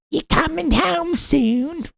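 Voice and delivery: very high, slow, silly British voice; mock British accent. Britain checking up on America, high mock British accent. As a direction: squeak out a needy British caller. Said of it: "what accent was that?".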